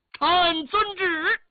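high, loud, long-drawn acknowledgement; obeying a decree. A narrator's reading. Prince accepts the emperor's decree, loud drawn-out cry. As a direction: sing out the official's reply slowly.

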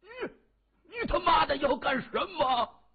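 high, loud angry yell; enraged shout at an attacker; furious roar. A narrator's reading. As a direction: roar it in desperate rage. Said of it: "他怒吼着".

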